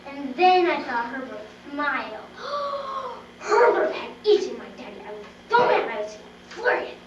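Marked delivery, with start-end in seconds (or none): 2.2-3.3 high shocked gasp. Acted. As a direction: gasp in horror. Discovers daddy eaten.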